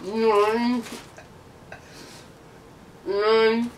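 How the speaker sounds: slow, mouth crammed with marshmallows; cheeks stuffed full. Real, not acted. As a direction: slowly mumble through stuffed cheeks. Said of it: "marshmallows in your mouth".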